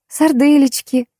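high, meek bleating offer; timid bleat. A narrator's reading. Narrator offering food to glowering relatives, bleated high and meekly. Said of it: "заблеяла я".

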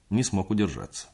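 plain reading voice

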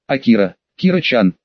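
usual speaking voice

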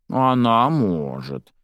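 slow, drawn-out concession; sighing. A narrator's reading. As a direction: say it on a slow sigh. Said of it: "вздохнула Кьяра".

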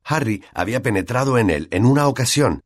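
usual speaking voice

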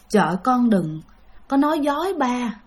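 put-on soft, resigned old man, half a whisper; gently asking a truth he already knows; sad weary grandfather. Dying grandfather quietly asks his daughter if his child is dead.

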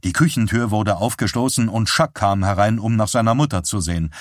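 ordinary delivery, nothing marked

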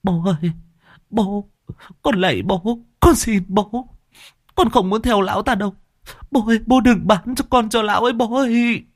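high, tearful begging; tearful pleading. A narrator's reading. Daughter begging father in tears not to sell her. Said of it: "Cô Bình mới khóc lóc".

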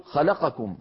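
"خلقكم" said fast as khalaqkum, last vowel swallowed; rushed, wrong. Shown as a demonstration. Teacher shows the hasty wrong reading. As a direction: clip "خلقكم" quickly, dropping a vowel. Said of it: "هكذا بسرعة".

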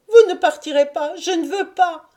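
high, impassioned cry of refusal; desperate cry. A narrator's reading. Lucienne refuses to let her fiancé leave, desperate high cry. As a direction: cry it out to hold him back. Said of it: "cria-t-elle".

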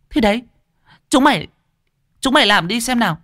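high, scornful dare; dismissive huff. Acted. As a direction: wave them off with a sneer. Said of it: "Bà hừ một tiếng, tỏ ý mặc kệ".

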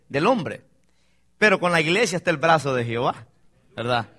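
ordinary talk

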